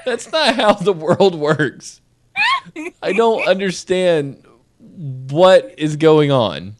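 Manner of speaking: slow, drawn-out disbelief; baffled by the courtroom story, slow and incredulous. Real, not acted. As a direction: spell out the bafflement word by word. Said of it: "That is a cartoon".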